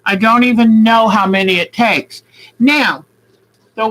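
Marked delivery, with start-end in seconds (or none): none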